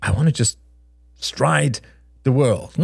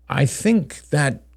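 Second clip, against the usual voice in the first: ordinary delivery, nothing marked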